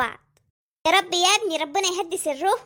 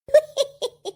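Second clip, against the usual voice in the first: bright high-pitched laugh bursting out; amused high laugh; 8 semitones higher than usual. Acted. Mother laughs at husband.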